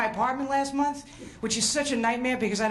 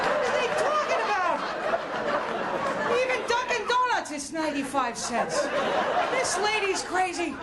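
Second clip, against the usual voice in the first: incredulous father; 7 semitones higher than usual. Retold or imitated. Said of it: "both sitting there thinking".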